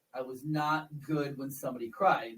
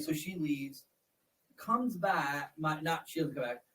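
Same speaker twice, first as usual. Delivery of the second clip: usual speaking voice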